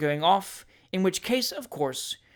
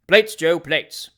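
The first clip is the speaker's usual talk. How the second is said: loud call to the servant; 9 dB louder, much slower than usual. A narrator's reading. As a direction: call the boy over the noise. Wardle calls to fat boy.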